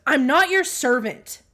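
imitating a defiant kid, high and loud; loud, high bratty outburst, retold. Child at the doorway talks back to mom, bratty. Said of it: "That was kinda bratty".

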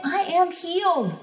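high, ringing declaration; emphatic, high-pitched claim of victory; declaring attitude of faith. Shown as a demonstration. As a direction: proclaim it as yours with total conviction. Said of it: "that's gotta be your attitude".

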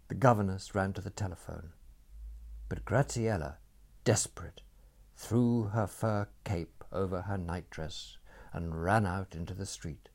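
plain reading voice

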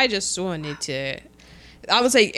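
usual speaking voice

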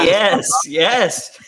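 thrilled that someone got the joke; delighted agreement. Real, not acted. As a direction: burst out in emphatic agreement.

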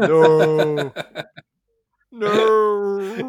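high, loud, drawn-out no; hammed-up villain's howl of despair; mock anguished shout. Joking about Palpatine rebuilding her, mock anguished shout. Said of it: "and just shout".

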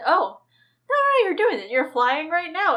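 lightly voiced coaxing crow; reassuring crow. Crow tells Bran he's flying, lightly acted encouragement.

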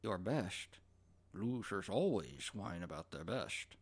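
low, quiet Sean Connery impression; lightly voiced Connery, slow and low; imitating Connery. Fan quoting Connery's line, low and slow. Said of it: "Connery just looks at him and says".

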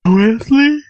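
high loud squeaky name call; different voice. Shown as a demonstration. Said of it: "In a different voice".

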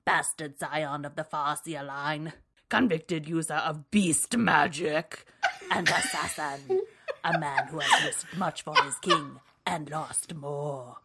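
theatrical blurb reading, lively and dark; dramatic audiobook voice. Pretend. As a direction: read it like an audiobook narrator. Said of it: "I did it in a little bit of a voice".

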